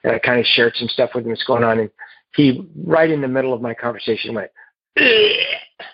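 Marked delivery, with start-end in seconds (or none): 5.0-5.9 imitating a high retching gag; hammed-up retch; mock retching. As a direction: act out gagging in disgust, well above your own pitch. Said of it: "he throws up again".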